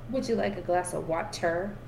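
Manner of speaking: "water" with a careful final R; pronounced R. Pretend. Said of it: "me saying my eyes, like".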